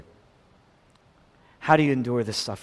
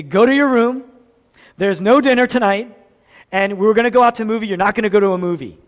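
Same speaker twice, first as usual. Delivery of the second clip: loud, higher scolding, racing at the end; scolding parent; 6 semitones higher, 10 dB louder, faster than usual. Retold or imitated. Preacher acts a parent punishing a lying child, loud scold. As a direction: bark it out like a stern parent.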